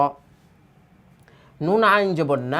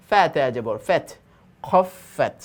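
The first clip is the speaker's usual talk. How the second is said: normal talking voice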